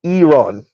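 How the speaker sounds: Iran said ee-RAHN, on its own; careful corrected pronunciation. Shown as a demonstration. Trying out Earth's correction, Iran carefully said as ee-RAHN. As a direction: open "Iran" with EE, then RAHN. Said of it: "it's pronounced Iran".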